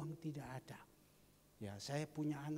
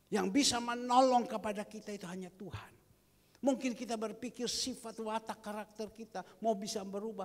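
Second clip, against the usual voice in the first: louder, higher fervent preaching; preaching at full force; 6 semitones higher, 7 dB louder than usual. Acted. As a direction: drive the image home. (khotbah berapi-api)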